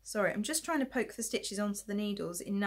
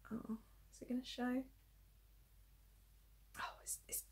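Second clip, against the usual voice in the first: whisper; 3 semitones higher, 16 dB quieter than usual. Real, not acted. Worried aside while checking knitting.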